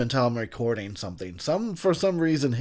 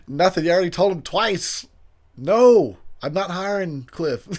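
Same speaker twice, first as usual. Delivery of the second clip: raised pitch, loud punched no; exasperated refusal; 5 semitones higher, 5 dB louder than usual. Acted. Voicing the guy who already told him twice, exasperated. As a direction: refuse like you're fed up being asked.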